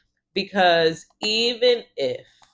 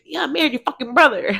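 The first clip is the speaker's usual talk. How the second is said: put-on New York accent; 9 semitones higher, 4 dB louder, faster than usual. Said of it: "it was very New York".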